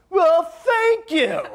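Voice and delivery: loud, high, drawn-out sarcastic thanks; furious mock gratitude, stretched and high; pissed-off thank you. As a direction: spit out thanks you don't mean. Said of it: "I'm really pissed off".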